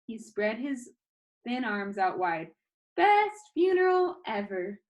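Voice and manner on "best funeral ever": cheerful boast. Acted. Danny cheerful in video.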